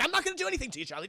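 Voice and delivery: high, placating villain voice; cartoonish high reassurance; Joker. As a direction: pitch it up into mock innocence. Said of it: "Even Joker's like".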